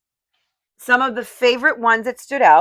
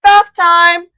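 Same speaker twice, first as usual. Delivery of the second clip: higher, louder announcement of bath time to the dishes; playful announcement; 9 semitones higher, 11 dB louder than usual. Retold or imitated. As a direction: call the dishes to their bath like a parent. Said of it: "they tell the dishes".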